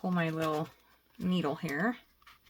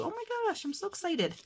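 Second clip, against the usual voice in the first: much higher, breathless delight; thrilled; 12 semitones higher than usual. Real, not acted. Crafter squealing about her lucky leaves. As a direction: squeal your excitement.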